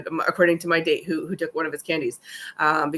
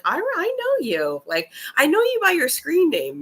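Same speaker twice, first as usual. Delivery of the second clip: high, gushing and slowed; excited; 10 semitones higher than usual. Real, not acted. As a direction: squeal it with delight. Gushing to her commenters.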